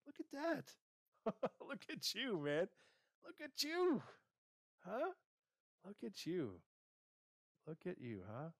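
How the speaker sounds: high, laughing cheer, look at you. Pretend. Host celebrates the listener reaching the goal, laughing cheer. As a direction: marvel at them, whooping with delight.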